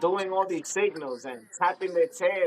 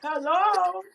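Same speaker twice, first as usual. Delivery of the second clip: calling out; 13 semitones higher than usual. Retold or imitated. Calling out to everybody.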